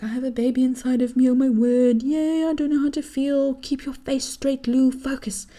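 barely holding in the giddy news; giddy inner voice. Retold or imitated. Excited inner monologue, hiding news.

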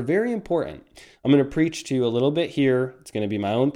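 ordinary talk, nothing marked

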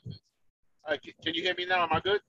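normal talking voice

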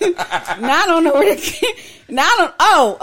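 flustered, real giggling fit; laughing. Real, not acted. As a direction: lose the thread in laughter. Flustered, laughing after crude joke.

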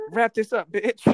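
lightly voiced pushy friend cutting you off; mock bossy friend. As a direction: bark it like the impatient co-host. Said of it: "She'd be like".

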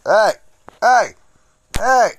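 loud sharp hey; calling out. Real, not acted. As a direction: demand attention. Calling partner for answer.

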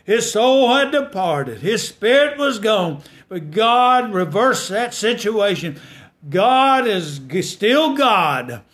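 climbing gospel preacher cadence; preaching at full force. Acted. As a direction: lift the congregation up. Preacher builds again.

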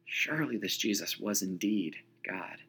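hushed, breathed whisper of wonder; awed whisper. Acted. Preacher whispers Peter's awe to the congregation, hushed. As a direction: let it out as an awed secret. Awed aside about Jesus.

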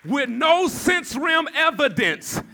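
building to a loud shouted declaration; full-force preaching. Real, not acted. Preacher declaring healing without evidence, full-force preaching.

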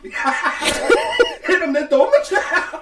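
loud, high, laughing through the words. Real, not acted. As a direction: crack up while agreeing. Laughing agreement.

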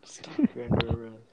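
whispered mock cop order. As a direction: hiss it like a secret arrest. Repeats the mock arrest.

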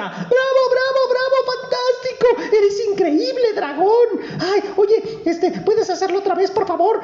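very high delighted squeals; cheering princess. Acted. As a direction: cheer him on like a thrilled little girl.

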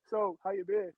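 high, soft mock small talk; awkward small talk. Mocking stiff movie dialogue, high and soft. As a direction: toss it off awkwardly. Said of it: "the dialogue was just like".

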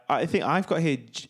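normal talking voice

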